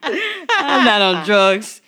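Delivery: slow, laughing, awkward denial. Pretend. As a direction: say it slowly and awkwardly. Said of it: "That was the awkward voice".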